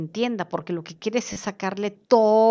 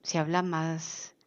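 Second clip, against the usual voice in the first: slow, much softer, lower cadence; slow and sweet; 5 semitones lower, 8 dB quieter, slower than usual. Shown as a demonstration. Teacher demonstrating matching a slow speaker, slow and soft. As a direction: ease way down like soothing someone. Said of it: "cadencioso, más dulce".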